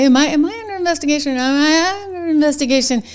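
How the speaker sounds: mocking quote. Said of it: "She'd been asking".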